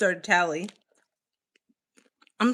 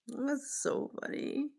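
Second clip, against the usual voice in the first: much softer, trailing repeat; 5 semitones higher, 11 dB quieter than usual. Real, not acted. Repeating to herself after laughing, soft. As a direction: say it again under your breath.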